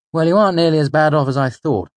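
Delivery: high, loud, quick character voice; Bast, brisk. A narrator's reading. Voices Bast assessing the wounds.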